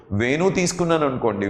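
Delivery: ordinary delivery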